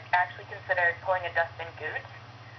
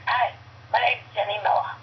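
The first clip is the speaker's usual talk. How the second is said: put-on hoarse smoker's voice, sweet Boston girl; Jenny Miller; 3 semitones higher than usual. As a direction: rasp it out like a lifelong smoker. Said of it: "this is literally what she sounded like".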